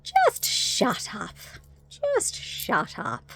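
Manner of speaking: slow, high, drawn-out shushing; mock-weary repeated dismissal; goblin helper. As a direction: imitate a fed-up goblin, stretching each word. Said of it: "the little goblin guy does that was helping Sarah".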